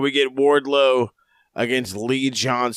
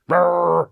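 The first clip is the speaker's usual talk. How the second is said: louder, higher mock roar; mock banshee roar; 6 semitones higher, 5 dB louder than usual. Describing a wrestler's scream, mock banshee roar. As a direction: roll out a playful banshee roar. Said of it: "he screams like a banshee".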